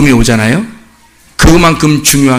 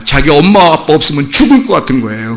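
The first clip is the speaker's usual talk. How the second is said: ordinary talk, nothing marked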